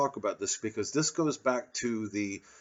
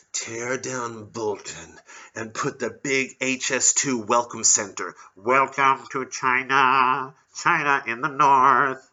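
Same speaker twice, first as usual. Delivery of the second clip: slow, louder posh toff voice; posh Etonian; 3 semitones higher, 6 dB louder, slower than usual. Retold or imitated. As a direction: gloat over the land grab, slowly. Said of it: "these fucking Etonians".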